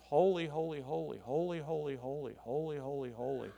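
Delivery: quick, hushed run of "holy" in threes; soft rhythmic repetition, trailing away; trinity of threes. Shown as a demonstration. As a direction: rattle off the nine "holy"s softly, fading as they go. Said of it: "a trinity of threes".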